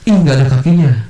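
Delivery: high childish exclamation, acted; spontaneous small child. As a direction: mimic a child's startled remark. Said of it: "anak kecil yang spontan".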